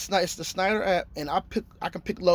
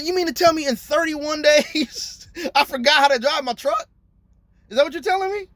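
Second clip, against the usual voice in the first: higher, mock-outraged disbelief; retold incredulity; 8 semitones higher, 3 dB louder than usual. Driver retelling telling off the company, higher incredulous. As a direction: replay the argument, pitched higher and indignant.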